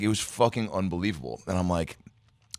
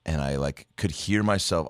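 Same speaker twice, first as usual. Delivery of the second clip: normal talking voice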